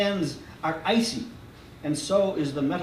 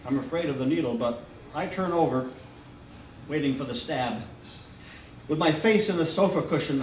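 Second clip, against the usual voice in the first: usual speaking voice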